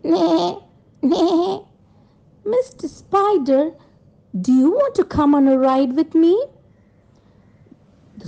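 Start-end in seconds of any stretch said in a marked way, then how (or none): 0.0-6.5 high-pitched friendly horse voice; neigh, then invite the spider along in a higher voice; storybook horse for children. Acted. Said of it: "What does the horse say?".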